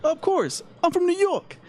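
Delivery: high, hammed-up New Yorker boast; mock Sopranos-style New Yorker; New York Italian guy. Tony quoted with New York pride.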